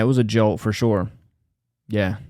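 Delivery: ordinary delivery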